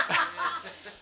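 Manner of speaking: high-pitched burst of laughter. Real, not acted. Laughing at the story.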